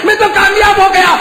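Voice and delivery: high, loud triumphant cry; shouted. Acted. Martyr proclaiming success to God, loud high cry. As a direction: cry it out in victory. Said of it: "repeated line".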